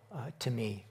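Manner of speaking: ordinary delivery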